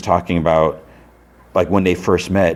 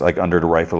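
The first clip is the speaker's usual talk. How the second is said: usual speaking voice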